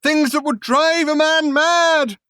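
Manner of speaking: high, loud, slow horrified cry; shouted horror. Acted. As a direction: drag it out in terror. Man still shouting in horror.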